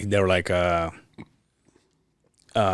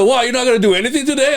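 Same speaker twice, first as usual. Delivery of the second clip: higher, fast mock nag; mocking coworker; 10 semitones higher, faster than usual. Imitating a coworker needling him about sleeping in, higher and fast. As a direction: rattle it off like a nosy coworker. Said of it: "He said".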